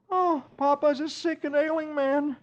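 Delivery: milk the sickness; moaning Dagwood. Acted. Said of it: "Dagwood moans".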